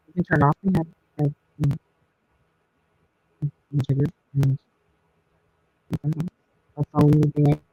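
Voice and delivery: muffled, as if underwater; crackly underwater sound. Real, not acted. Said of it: "it sounds like you're underwater and crackly".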